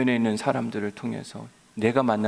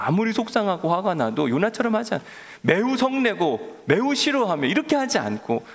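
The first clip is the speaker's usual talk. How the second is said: ordinary delivery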